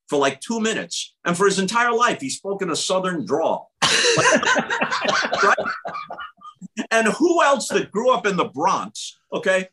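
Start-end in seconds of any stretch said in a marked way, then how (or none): none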